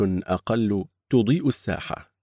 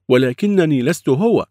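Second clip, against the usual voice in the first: protesting; 8 semitones higher, 8 dB louder than usual. Acted. Protesting denial.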